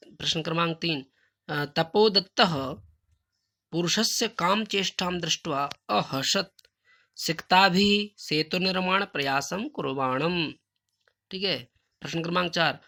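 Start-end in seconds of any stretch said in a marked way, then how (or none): 1.6-10.5 slow recitation. Shown as a demonstration. Teacher recites Sanskrit question.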